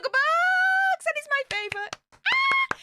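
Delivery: high squealing delight. Real, not acted. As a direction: squeal with real joy at the gift. Thanked for donation, squeals delight.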